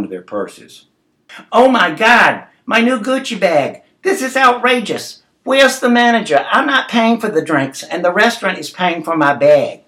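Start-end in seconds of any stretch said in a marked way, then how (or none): 1.5-9.8 high, loud indignant shrieking; outraged customer. A narrator's reading. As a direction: protest like an affronted, demanding diner. Acted furious customer after spilled drinks.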